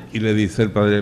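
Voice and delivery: ordinary talk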